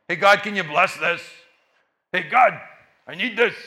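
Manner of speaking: loud, clipped-off pleading; mock needy asking. [name] mimics his own demanding prayers to God, loud. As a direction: parody a selfish prayer.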